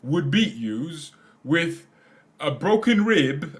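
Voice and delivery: mock Tyson Fury boast, drawn out; Tyson Fury impression. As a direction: do the boxer boasting about his brother, slow and loud. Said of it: "a video of Tyson Fury saying".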